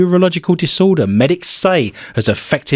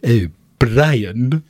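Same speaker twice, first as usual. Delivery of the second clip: mock British accent; 4 semitones lower than usual.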